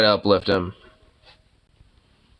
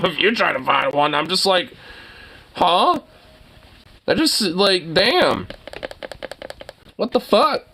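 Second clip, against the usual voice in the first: incredulous rant; 10 semitones higher than usual. Real, not acted. Ranting about mattress search.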